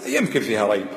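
low, hesitant mock doubter; doubter's voice. Preacher mimics a doubter questioning the Hour, mock doubt. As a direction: voice the doubter's shrug lightly. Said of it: "يقول واحد".

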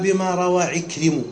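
slow demo: "بما روى عكرم" with final ta dropped, ending on a damma after the mim; "عكرم" said as ikrimu, no ta at the end; truncated name ending in -mu. Shown as a demonstration. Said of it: "فتقول أنت الآن".

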